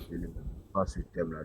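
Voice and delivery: usual speaking voice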